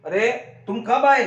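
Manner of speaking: ordinary delivery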